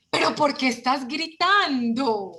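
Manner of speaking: acted exasperated shout, drawn out; mock parental yelling. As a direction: scold a child while shouting yourself. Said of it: "Usted también está gritando".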